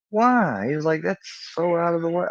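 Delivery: mock-shocked friend, squeaky high; incredulous why. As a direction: friend baffled by the long way round, high and incredulous. Friend objects to the route.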